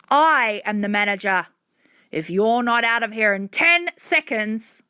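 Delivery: loud, threatening man; forceful shout building to a threat; angry yelling. A narrator's reading. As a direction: yell an ultimatum at intruding kids. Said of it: "He stopped yelling".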